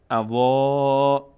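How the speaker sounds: "اوا" with its last vowel held; elongated alef. Shown as a demonstration. Teacher showing the alef is stretched. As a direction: draw out the end of "اوا", loud and level.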